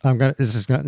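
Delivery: normal talking voice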